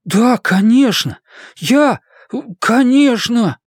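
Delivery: high, slow, drawn-out eager yes; overwhelmed agreement. A narrator's reading. Blaise accepts Bluenote's offer, overwhelmed and eager. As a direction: stumble into agreeing, pitched right up.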